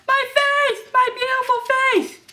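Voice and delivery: very high-pitched vain shriek; horrified wail. Acted. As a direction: wail over your ruined looks. Villain wails over scarred face.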